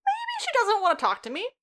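high-pitched mock musing; sarcastic, high imitation of someone catching on; mock realization. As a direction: put on a high voice for an obvious thought. Mocking his failure to take the hint.

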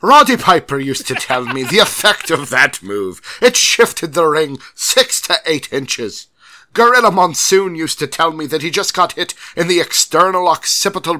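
loud mock British accent sliding toward Irish; wobbly fake British accent. Host resuming the email after the laughs, loud put-on British-Irish accent. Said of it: "I don't sound Irish anymore".

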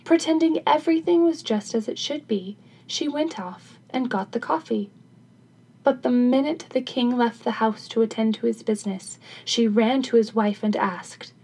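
ordinary delivery, nothing marked

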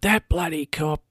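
lightly acted exasperated fury; mock meltdown. Woman throwing the light bulb at the wall, mock meltdown. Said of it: "She's having a meltdown".

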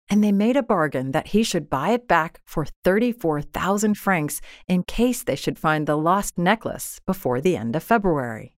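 ordinary delivery, nothing marked

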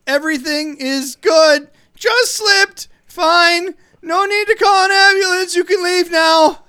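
high, loud strained voice insisting all is fine; falsetto fake-calm reply. To a stranger at the stall door, high strained insistence. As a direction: squeak out that nothing happened.

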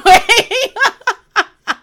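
high, loud real laugh; burst of laughter. Real, not acted. Laughing at her own rant, high and loud. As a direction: crack up at yourself.

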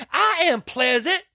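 very high, outraged mock protest; hammed-up indignation. Retelling Weezer insisting she is pleasant, high mock outrage. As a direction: stretch it out high, exasperated.

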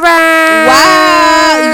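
loud wow; excited shout. Real, not acted.

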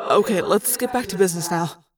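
usual speaking voice

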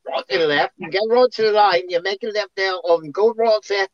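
imitating a Londoner. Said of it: "and they go".

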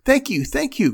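put-on high, excited child's thanks; delighted cry. A narrator's reading. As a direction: cry it out high like a thrilled little girl. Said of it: "cried Mink".

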